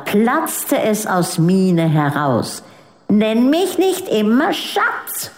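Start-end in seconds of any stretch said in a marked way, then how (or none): 3.1-5.3 put-on a girl bursting out at her mother; understated child's angry cry; child shouting. A narrator's reading. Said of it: "schrie sie".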